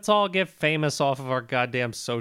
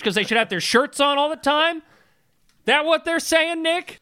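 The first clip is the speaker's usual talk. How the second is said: mock outrage; 11 semitones higher than usual. Heated mock accusation at Nick.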